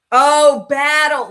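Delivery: loud, surprised "oh" as the penny drops; sudden realization. Real, not acted. Reacting to a viewer's question about battling, surprised realization. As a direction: catch on all at once, out loud.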